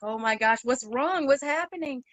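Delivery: mildly panicked retold questions, rising in pitch; freaking out. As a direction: act out a growing freak-out. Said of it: "freaking out".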